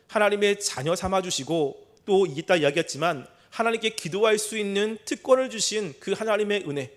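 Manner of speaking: ordinary delivery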